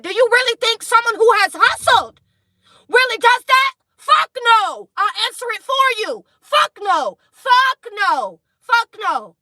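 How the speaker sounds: very high, loud angry yell; furious shout. Real, not acted. Reader yelling her answer at fake hustlers. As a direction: shout the answer with full force.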